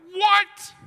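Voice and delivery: sudden high loud shocked yelp; shocked what. Retold or imitated. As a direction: squeal it, jaw on the floor. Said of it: "It was so surprised".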